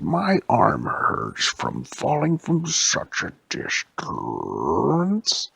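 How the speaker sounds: slow, whispery malfunctioning robot; a broken animatronic ape. Acted. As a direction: drag the words out like a busted machine. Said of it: "What's wrong with your voice?".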